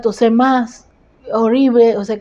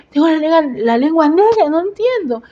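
high-pitched baffled reenactment; stretched, higher-pitched mock bewilderment; alarmed disbelief; 7 semitones higher than usual. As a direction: retell the shock in a raised, puzzled voice.

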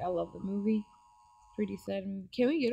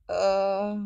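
ordinary talk, nothing marked

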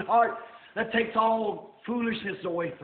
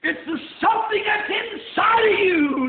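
preacher building to a high cry; impassioned sermon swell; full-force preaching; 4 semitones higher, 4 dB louder than usual. Real, not acted. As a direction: drive it home to the congregation, rising.

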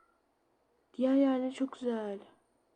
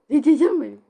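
much louder, sing-song Cici canım benim; showing how she says it; 11 dB louder, faster than usual. Shown as a demonstration. Repeating the chat line to viewers, loudly. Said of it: "Bak nasıl söylüyorum".

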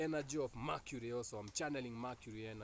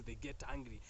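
ordinary talk

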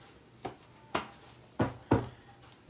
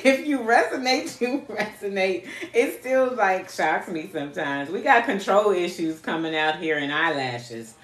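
ordinary delivery

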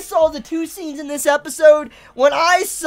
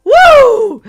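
venting yell, much higher and louder; loud high whoop; 10 semitones higher, 13 dB louder than usual. Real, not acted. Fan yelling out rage at the episode, loud whoop. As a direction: blow off steam with a whoop. Letting off steam.

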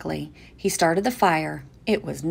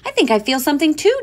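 excited; 10 semitones higher, 8 dB louder, faster than usual. A narrator's reading. Dad excited in play.

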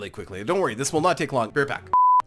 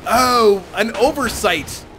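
higher, drawn-out cry of alarm; loud dismay; 6 semitones higher, 5 dB louder, much slower than usual. Real, not acted. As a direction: groan out the realisation of a blunder. Spots own mistake during battle.

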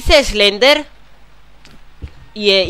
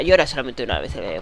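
ordinary delivery

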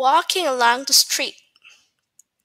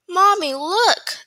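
higher, drawn-out excited call; little girl's cry; 6 semitones higher, 4 dB louder, slower than usual. A narrator's reading. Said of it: "the girl cried".

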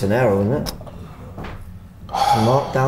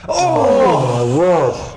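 surprised; 5 semitones higher, much slower than usual. Real, not acted. Big chance in the box.

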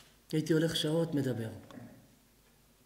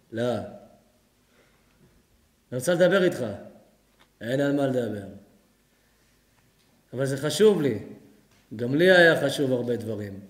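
grumpy refusing husband; 4 semitones lower, 6 dB louder than usual. Acted.

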